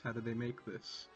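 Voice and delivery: ordinary delivery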